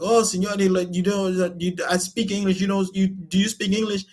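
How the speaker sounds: high, fast, hammed-up Mexican accent; mock Mexican salesman. Street timeshare seller pestering a tourist in a mock Mexican accent. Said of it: "the guy was like".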